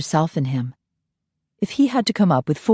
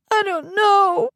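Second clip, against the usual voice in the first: put-on very high, slow small boy wail; wailing child; slower than usual. Little boy to the nanny, wailing. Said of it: "he wailed".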